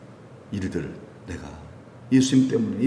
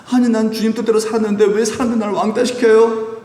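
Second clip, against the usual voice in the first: high, sustained protest; aggrieved complaint; 8 semitones higher, 4 dB louder than usual. Acted. Believer asks why people shun them, aggrieved and high. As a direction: plead the injustice in a raised pitch.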